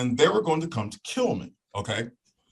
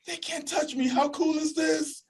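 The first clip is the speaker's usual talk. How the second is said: gleeful; 4 dB quieter than usual. Retold or imitated. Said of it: "I'm like".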